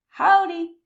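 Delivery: loud, high friendly shout; shouted howdy. A narrator's reading. Armadillo greeting his new friend, loud high shout. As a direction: call it out big and cheerful. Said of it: "he shouted".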